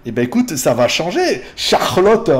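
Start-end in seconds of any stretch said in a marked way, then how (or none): 1.6-2.3 put-on German pronunciation of a name; German accent. Replying to Charlotte about her teasing, mock German accent. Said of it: "avec l'accent allemand".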